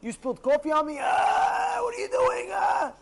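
very high, wailing mock tantrum; over-the-top furious yelling; angry reaction. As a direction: explode in a hammed-up screech of anger. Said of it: "You have a reaction".

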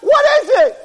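high, loud frantic questions; panicked boy. Acted. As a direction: beg to know, panicking. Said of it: "He's jumping up and down".

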